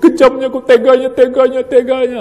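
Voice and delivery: a hint of bitter reproach at God; lightly hammed-up lament; mock wailing lament. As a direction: imitate someone cursing their fate. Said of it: "Apalagi sampai menyumpah-nyumpah".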